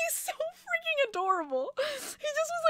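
very high, gushing voice; gushing squeal. Real, not acted. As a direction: squeal over how cute he is.

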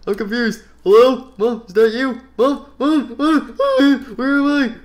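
high, loud frantic car voice; a lost taxi panicking; panicky taxi car voice. Pretend.

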